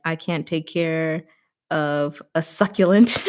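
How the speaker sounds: slow, giggling mock confession; laughing through it. Joking she cannot keep a succulent alive, slow and laughing. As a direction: say it while cracking up.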